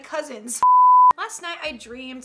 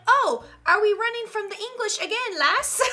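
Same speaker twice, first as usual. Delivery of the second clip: put-on high, lilting vague European peasant accent; unplaceable peasant accent; 7 semitones higher, 4 dB louder than usual. European peasant DNA fleeing the English, in a vague accent. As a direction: put on a muddled old-country peasant accent. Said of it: "I don't know what accent this is".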